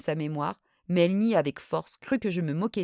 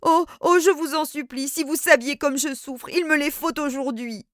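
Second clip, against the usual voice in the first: much higher, louder desperate begging; tearful pleading; 8 semitones higher, 5 dB louder than usual. A narrator's reading. Hypnotized woman begging her cousin for money, tearful. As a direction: plead as if on the verge of tears. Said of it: "Elle pleurait et bégayait".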